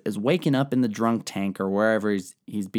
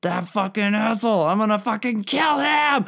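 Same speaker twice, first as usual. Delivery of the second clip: much higher, louder enraged outburst; furious queen; 11 semitones higher, 3 dB louder than usual. Acted. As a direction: explode with rage at her son's death. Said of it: "She's like".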